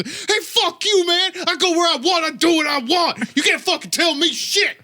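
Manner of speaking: high, strained defiant holler; hammed-up furious comeback; mock belligerent yelling. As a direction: yell back like you own the street. Retold belligerent man yelling back.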